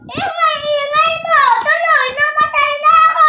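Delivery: upset complaint. Real, not acted. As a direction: wail it in protest at your playmate. Child complains after dying in game. (malakas na pagmamaktol)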